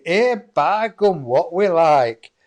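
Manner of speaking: put-on slow, loud broad Yorkshire old woman; broad Yorkshire accent. Medium voicing a dead Yorkshire grandmother, broad Yorkshire accent. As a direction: draw out a broad Yorkshire "here by gum". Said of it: "she's got a Yorkshire accent".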